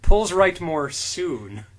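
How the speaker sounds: slow drawn-out recital; mock solemn. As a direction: stretch the words with relish. Reciting phone background, amused.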